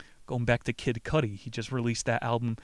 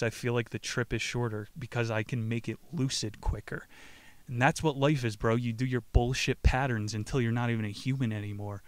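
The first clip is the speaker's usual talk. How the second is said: ordinary talk, nothing marked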